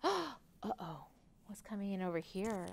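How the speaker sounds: gasp, then soft, quick aside; hushed concern. A narrator's reading. Narrator showing children the coming clouds, hushed concern. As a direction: drop to a worried hush. Storm approaching in the story.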